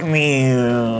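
ordinary talk, nothing marked

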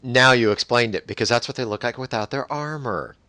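loud, high outburst of sudden understanding; excited realization. Real, not acted. Host finally understanding the stripped armor, excited realization. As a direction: exclaim it as the penny finally drops. Sudden light bulb moment.

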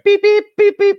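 high, loud mock beeping; imitating the phone beeping; phone beeps.

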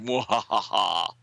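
slow villain mwahaha; hammed-up evil cackle; evil laugh. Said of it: "Someone is chewing the scenery".

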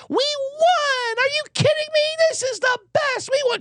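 mocking the Harbaugh mom's over-the-top joy. Host mocks Mama Harbaugh's gushing victory cheer in a high mock voice. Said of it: "And then the mom's like".

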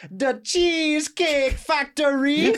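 high, slow, laughing mock Jamaican accent; laughing Jamaican accent imitation. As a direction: drawl it out in a hammed-up Jamaican accent. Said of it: "thought this would hit with a Jamaican accent".

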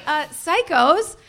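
ordinary talk

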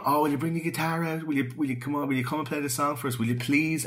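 high-pitched mock pleading; begging. Party guests asking him to play a song, imitated begging. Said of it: "they're begging him to interrupt them".

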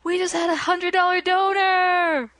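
high excited donation bell call; infomercial. Pretend. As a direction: ring the bell, cheer the donor. Mock telethon bell and donor.

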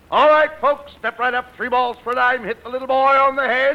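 high, loud pitchman call; loud carnival barker. Acted. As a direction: bark it out to a fairground crowd. Bud barking at the concession crowd.

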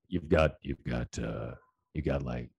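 low, late-night radio host purr; a lightly voiced smooth-jazz DJ; Quiet Storm DJ voice. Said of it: "Quiet Storm D J voice".

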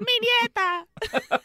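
put-on high, slow old lady cry; old screaming lady. Imitating the screaming old lady from the film. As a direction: wail it like an old woman. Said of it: "the old screaming lady".

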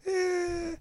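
drawn-out, soft, high noncommittal "eh"; Larry David. Acted. Host imitating the comedian's reaction to a signing, unimpressed. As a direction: mimic an unimpressed comedian's long "eh". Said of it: "You know, Larry David".

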